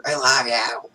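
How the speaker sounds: loud, hollered retold endearment; mock scream. Retelling screaming matches with mom, mock hollering. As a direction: yell it for the neighbours to hear. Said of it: "we just scream at each".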